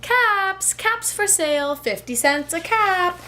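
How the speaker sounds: high sing-song vendor call; calling out wares. Acted. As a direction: call out your caps to the street. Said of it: "he called".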